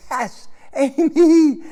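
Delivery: high, loud, slow relieved cry; father reunited with lost daughter, overjoyed and loud; overjoyed relief. Retold or imitated. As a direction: stretch out the name in huge relief.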